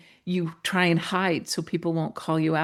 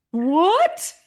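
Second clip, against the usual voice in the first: high, loud astonished yelp; 11 semitones higher, 6 dB louder than usual. Retold or imitated. Speaker answers a stranger's surprising compliment, loudly astonished. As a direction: blurt it out in high-pitched disbelief. Retold shock at stranger's remark.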